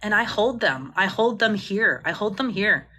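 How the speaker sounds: usual speaking voice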